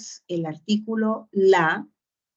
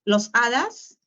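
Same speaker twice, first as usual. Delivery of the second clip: "los hadas" asked higher, with a rising, testing tune; doubtful wrong guess; 4 semitones higher than usual. Shown as a demonstration. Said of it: "No, tú dices". (pregunta dudosa, más aguda y ascendente)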